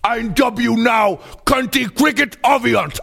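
hammed-up royal decree; grand proclamation. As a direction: knight the cricket with fanfare. Grand dubbing ceremony.

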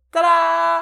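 ta-da. Acted. Presenting the finished recipe.

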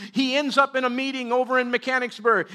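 ordinary talk, nothing marked

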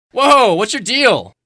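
loud, slow startled cry; startled shout. Acted. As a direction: shout it still shaken. Recovering from fright.

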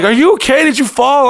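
very high-pitched mock concern; worried onlookers. People finding him behind the bar, alarmed. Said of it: "They'd be like".

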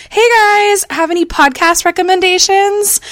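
high chirpy quoted question; perky poster voice. Retold or imitated. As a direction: imitate an eager online poster, high. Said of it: "the number one thing that's happening on Twitter".

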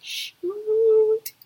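high startled blurt; mock shock. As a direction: blurt it out in amazement. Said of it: "I'd be like".

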